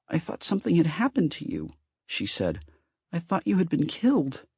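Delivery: high, tearful voice of the goddess; weeping. A narrator's reading. Weeping goddess tells Ben she feared him dead. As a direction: let the tears break through. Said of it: "Hestia wept".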